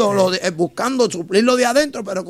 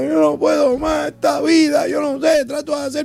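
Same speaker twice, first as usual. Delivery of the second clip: imitating a broken man begging for help; desperate crying plea; 6 semitones higher than usual. Preacher imitating a crying man who came to him. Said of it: "vino donde mí llorando".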